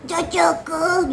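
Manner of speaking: put-on slow, throat-pressed elderly voice; pressed old voice. Shown as a demonstration. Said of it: "sambil ditekan di tenggorokan".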